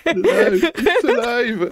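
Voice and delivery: high, loud mad scientist cry; mad scientist shout. Pretend. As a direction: shout it like Frankenstein's doctor. Frankenstein quote shouted.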